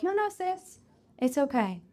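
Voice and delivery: calm the panicky voice down like a big sister; reassuring self-talk. Acted. Answering her own anxious inner voice, soothing and gentle. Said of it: "you'll be like".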